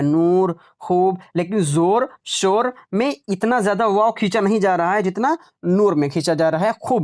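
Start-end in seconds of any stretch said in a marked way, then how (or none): 0.0-1.2 नूर and खूब held on the oo; drawn-out vowel. Shown as a demonstration. Teacher repeats the long-waw examples, नूर and खूब stretched. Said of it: "ये खींच कर पढ़ा जा रहा है".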